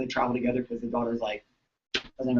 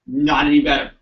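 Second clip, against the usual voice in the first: louder flat complaint; blunt complaint; 8 dB louder than usual. Retold or imitated. Daughter telling the chiropractor nothing changed, loud. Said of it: "Daughter's like".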